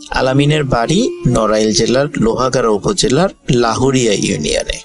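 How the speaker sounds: ordinary delivery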